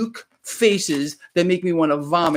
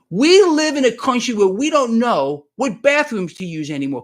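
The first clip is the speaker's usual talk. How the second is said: louder, higher declaration; 7 semitones higher, 6 dB louder than usual. Real, not acted. Host braces for hate mail, says it loud. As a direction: belt it out defiantly. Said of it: "and I'm gonna say it loud".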